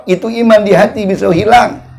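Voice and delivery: usual speaking voice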